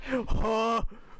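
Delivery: sharp, high gasp of disbelief; shocked gasp. Real, not acted. Streamer reading slander from chat, shocked gasp. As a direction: gasp as if scandalised.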